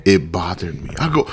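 normal talking voice

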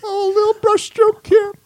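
very high, slow, wailing parody; mocking silly voice. Host mocking arts lover painting brush strokes, high wailing silly voice. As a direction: drag it out in an absurd high voice.

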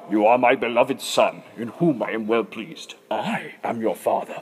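deep, low, solemn voice of God; James Earl Jones. Retold or imitated. God speaking from heaven, deep movie-father voice. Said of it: "just imagine James Earl Jones".

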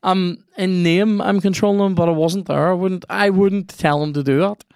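high, indignant protest. Real, not acted. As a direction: insist you're innocent. Defending himself to friend.

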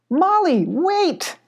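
high urgent call after a runner. Acted. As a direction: call ahead, pitch way up. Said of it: "called Abby, running as fast".

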